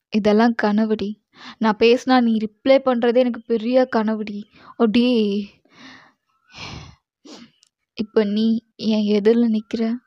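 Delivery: usual speaking voice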